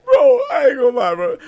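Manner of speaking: loud shout while crying with laughter; real laughing yell. Real, not acted. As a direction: yell it through tears of laughter. Laughing hard at the clip.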